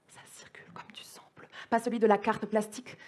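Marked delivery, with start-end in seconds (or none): 0.1-1.5 barely audible, voiceless whisper; whispered refrain. Acted. Poet repeating the blue-blood refrain to listeners, in a whisper. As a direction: whisper it like an echo.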